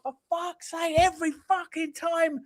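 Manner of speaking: high, slow mock-angry curse; exasperated fan cursing; retold frustration. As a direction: replay the moment of fury, drawn out. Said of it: "I was like".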